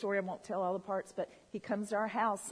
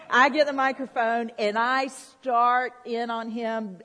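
loud, slow, drawn-out build-up; preacher winding up, louder; emphatic retelling; 9 dB louder, slower than usual. Retold or imitated. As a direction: belt it out slowly for the crowd. Retells charging at uncle with mic.

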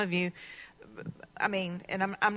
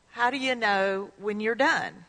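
ordinary talk

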